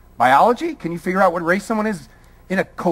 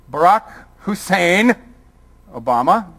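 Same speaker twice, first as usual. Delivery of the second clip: slow, deliberate "Barack Hussein", pause before "Obama"; pointed naming. Retold or imitated. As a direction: lean on "Hussein", mimicking a loaded way of naming. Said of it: "as Fox News likes to call him".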